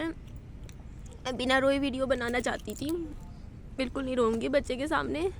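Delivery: swallowing the tears as she speaks; holding back tears. Real, not acted. Mother to viewers, trying not to cry in a soft tearful voice. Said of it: "बच्चे के सामने रोना नहीं चाहिए".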